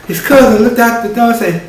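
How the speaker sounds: ordinary delivery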